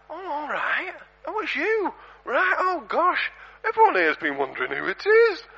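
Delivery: high, chatty lady voice; lady on the phone. Retold or imitated. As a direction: pitch it up like a flustered churchgoer on her phone. Lady answers phone in church.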